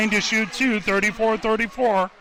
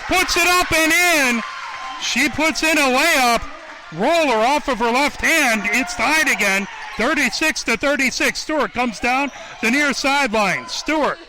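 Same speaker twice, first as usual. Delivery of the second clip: excited shout; 5 semitones higher than usual. Real, not acted. Commentator shouting as the layup ties the game, excited. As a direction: burst out with the tying layup.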